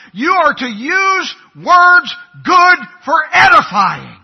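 loud, emphatic pulpit shout; full-force preaching. Real, not acted. Preacher rebuking angry husbands, full-force preaching. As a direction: drive each charge home at full volume.

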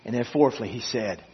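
very soft, low, with long pauses; hushed sermon closing. Real, not acted. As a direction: let each phrase sink into the silence. Hushed closing after long silence.

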